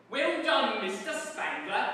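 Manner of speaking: hammed-up cockney warder; gloating warder. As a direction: crow it like a smug jailer. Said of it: "said one of them".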